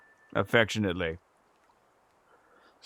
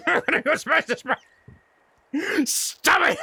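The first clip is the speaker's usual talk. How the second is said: laughing mock dad; 9 semitones higher than usual.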